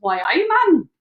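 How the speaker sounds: a rough, lightly done Geordie "Why, aye, man?" ending on a firm "man"; bad Geordie accent. Co-host attempts her three Geordie words, in a bad Geordie accent. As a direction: try the only Geordie she knows, half-hearted. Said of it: "I can only do three words".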